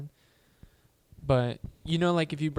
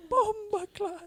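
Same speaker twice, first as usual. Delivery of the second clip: "Bomba clot" slower and high, laughing through it; Jamaican accent; slower than usual. Shown as a demonstration.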